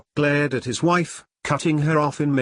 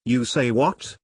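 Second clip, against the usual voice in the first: ordinary delivery, nothing marked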